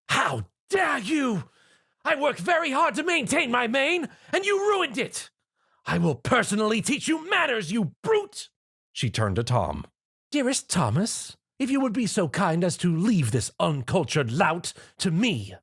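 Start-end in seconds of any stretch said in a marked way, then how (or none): 0.1-8.5 high, indignant fury; a prim lady scolding a brute; outraged lady. A narrator's reading. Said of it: "Her expression completely changed from fear to rage". 10.3-15.6 a lady asking with icy politeness; sweetly polite request. A narrator's reading. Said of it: "Rarity requested".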